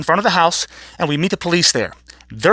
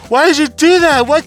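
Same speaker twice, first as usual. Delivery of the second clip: higher, louder cry of protest; indignant protest; 9 semitones higher, 5 dB louder than usual. Retold or imitated. Hurt kid confronting the babysitter, indignant protest.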